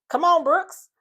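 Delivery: calling out high and loud to Brooks; urging call. Retold or imitated. Cast member loudly urging Brooks to talk, calling out. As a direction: push him to finally speak up.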